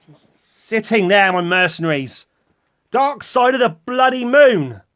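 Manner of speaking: loud, high, slow mock indignation; mock outrage. Vlogger loudly mocking the Dark Side of the Moon fans, hammed-up outrage. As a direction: bellow it like a wronged man.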